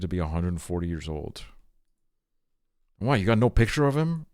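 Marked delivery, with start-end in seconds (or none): 3.0-4.3 indignant question. Real, not acted. Indignant at missing lobster picture.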